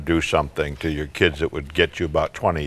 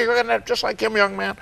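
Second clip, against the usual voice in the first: high nagging verdict, a little softer; scolding parent; 13 semitones higher, 4 dB quieter, faster than usual. Acted. Parent warning son about Uncle Roger, high and nagging. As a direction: wag the finger and wind down. Mom scolding over spilled milk.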